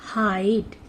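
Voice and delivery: हाइट with a long, clear ai; long vowel hite. Shown as a demonstration. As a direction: stretch the ai in हाइट. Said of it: "H I D E". (लंबी आइ के साथ हाइट)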